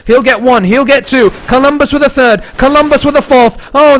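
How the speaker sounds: ordinary talk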